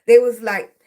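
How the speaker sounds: usual speaking voice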